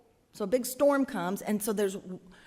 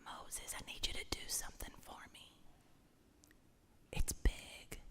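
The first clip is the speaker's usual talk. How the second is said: God whispering a big request; hushed, secret ask in a whisper; 16 dB quieter than usual. Acted. God whispering to Moses, hushed whisper. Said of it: "if it were just".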